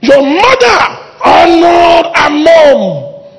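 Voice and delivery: slow, loud booming rebuke; loud, drawn-out fatherly threat; mock stern warning. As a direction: warn the kids off like a fierce father. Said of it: "I will tell them stories".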